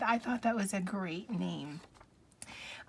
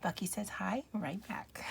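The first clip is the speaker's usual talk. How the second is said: whispered for the pet; 5 dB quieter than usual. Pretend. Talking for the pet.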